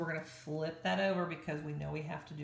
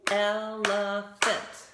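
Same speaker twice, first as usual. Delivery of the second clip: "Elephant" slow and loud, el-e-phant; "Elephant" chanted in claps; clapped syllables; 2 semitones higher, 7 dB louder, much slower than usual. Shown as a demonstration. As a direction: beat out "Elephant" syllable by syllable, loudly. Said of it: "Three claps".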